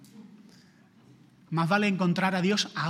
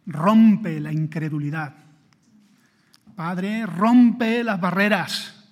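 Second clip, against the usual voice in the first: fervent prayer; 5 dB louder than usual. Real, not acted. Closing prayer.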